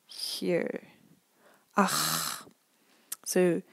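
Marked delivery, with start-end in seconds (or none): 1.8-2.5 "Ach" with a rough, throaty "ch" at the back; "Ach" with a back-of-throat rasp; German ach sound. Shown as a demonstration. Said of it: "It's really at the back".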